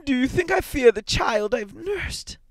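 high, loud indignant mother; frantic old woman's defiance; impassioned challenge. A narrator's reading. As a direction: fling the question out in a high, fervent voice.

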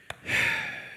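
long audible sigh. Acted. Father about to answer his weeping son, sighing. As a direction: breathe out a heavy sigh before answering. Before Isaac's answer to Esau.

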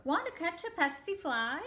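high, perky invitation; storybook rooster. Acted. Rooster invites the spider to catch flies, high perky voice. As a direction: voice a chirpy rooster asking. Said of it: "crowed the rooster".

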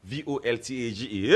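usual speaking voice

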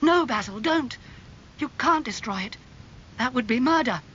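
high, urgent protest; acted alarm, pitched well up; alarmed pleading. A narrator's reading. Dorian stops the knife attack.

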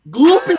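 high cracking wail; silly wail. Pretend. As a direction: wail it out absurdly. Wailing silly word.

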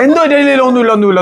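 high cooing hush, gliding downward. Real, not acted. Uncle comforting his upset little nephew, soothing baby talk.

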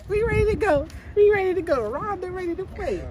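high put-on girl voice; squeaky falsetto girl voice; man doing a woman's voice. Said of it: "we gotta practice our girl voice".

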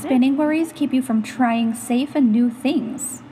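normal talking voice

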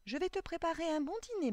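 put-on high, quick, doting old man; Coppelius. A narrator's reading. Old clockmaker talks to his doll, high quick doting voice. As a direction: promise it in a high, eager old voice. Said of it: "dit Coppelius".

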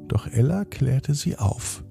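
plain reading voice

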